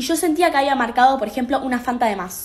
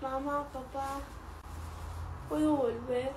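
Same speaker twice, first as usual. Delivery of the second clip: much softer, small voice; sheepish plea; 14 dB quieter than usual. Acted. Daughter asking her parents to take her back, meek and soft. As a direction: ask meekly to come home. Daughter returns after five days.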